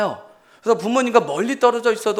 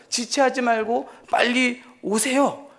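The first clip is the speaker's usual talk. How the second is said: higher, slow plea of a desperate David; pleading prayer; 6 semitones higher, slower than usual. Acted. Preacher voicing David begging God to hurry, slow pleading. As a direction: beg him slowly to hurry.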